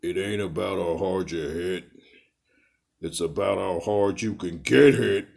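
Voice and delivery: low, thick-voiced boxer impression, starting subdued; Rocky Balboa. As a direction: do a Stallone-style pep talk, building from low. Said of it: "in the words of Rocky Balboa".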